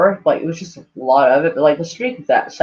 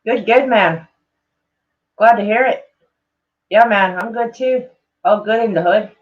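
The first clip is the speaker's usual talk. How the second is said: put-on a wobbly Scottish accent drifting toward Irish, slightly higher; Scottish accent slipping into vague Irish, raised pitch; attempted Scottish accent; 4 semitones higher than usual. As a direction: try a Scottish brogue, half-landed. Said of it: "read his tweets like this".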